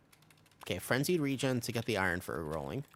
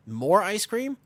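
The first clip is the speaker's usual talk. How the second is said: excited; 10 semitones higher, 7 dB louder, slower than usual. Real, not acted. Excited question about eating more.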